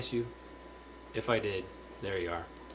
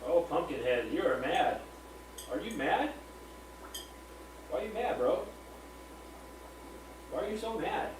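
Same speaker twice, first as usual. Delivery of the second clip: playful teasing; 6 semitones higher, 6 dB quieter than usual. Real, not acted. Streamer needling his pet rock bass Pumpkinhead, playful high-pitched teasing. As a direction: coo teasingly at a grumpy pet in a high, lilting voice.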